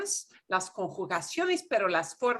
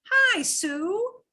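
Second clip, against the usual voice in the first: bright, much higher, chummy greeting; high casual hello to a friend; friendly casual hi; 11 semitones higher than usual. Shown as a demonstration. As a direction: greet Sue like an old friend, pitched well up. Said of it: "you know Sue by her first name".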